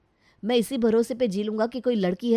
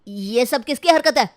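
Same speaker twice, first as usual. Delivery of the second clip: loud, higher, outraged demand; flaring up angrily; 4 semitones higher, 8 dB louder than usual. Acted. Husband seeing palak paneer on the table, flaring up angrily. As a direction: burst out in anger. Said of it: "देखते ही भड़क जाता है".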